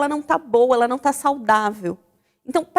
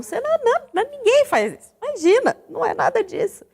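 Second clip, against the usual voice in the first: much higher, mock-innocent denial; sarcastic denial; 11 semitones higher, faster than usual. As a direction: deny it with obvious irony. Ironic denial to Raquel. (negação irônica, fingindo inocência)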